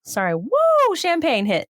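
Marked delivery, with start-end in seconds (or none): 0.4-0.9 high, rising "woo"; real tipsy whoop. Real, not acted. Speaker feels the champagne hit, real whoop.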